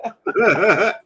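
high, airy burst of laughter; high giggle. Real, not acted. Giggles at his own taxi joke, high laugh.

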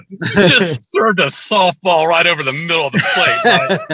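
laughing while telling it; laughing aside. Retold or imitated. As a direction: chuckle through the line.

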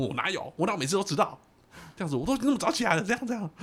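high, loud indignant outburst; mother exploding. Retold or imitated. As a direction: fire back at the accusation in outrage. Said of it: "结果我妈就爆炸了".

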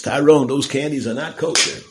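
loud, high mock scolding of a child; scolding mother. Mother scolds pestering child in joke.

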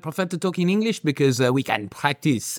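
measured, put-on French accent; courteous menace in a mock French accent; French villain accent. French villain practising English while threatening to kill, French accent. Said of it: "The French do make great villains".

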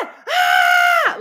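high, loud scream of panic; a sudden high, loud shriek; panicked yell. Retold or imitated. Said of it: "I literally was like this".

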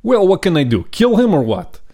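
put-on high, heavy Russian accent as the father. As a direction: play the weary Russian father, thick Russian accent. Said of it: "a Russian accent".

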